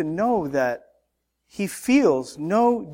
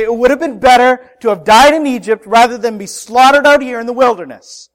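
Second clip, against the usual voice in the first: loud, raised complaining cry; upset complaint; 3 semitones higher, 7 dB louder, faster than usual. Retold or imitated. Israelites at the Red Sea crying out, loud complaint. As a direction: cry out your panic at God. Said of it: "They're upset, and they say".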